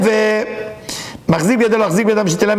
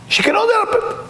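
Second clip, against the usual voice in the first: 1 excited cry. Real, not acted. Preacher describing his generous mom.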